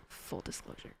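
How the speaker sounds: Full disclosure, whispered with no voice at all; whispered aside. Shown as a demonstration.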